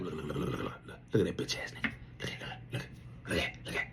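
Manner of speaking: hushed urgent whisper. Retold or imitated. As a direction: whisper so the commander can't hear. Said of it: "we can't say it loud".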